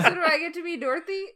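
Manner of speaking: eager. Retold or imitated. Quoting eager student.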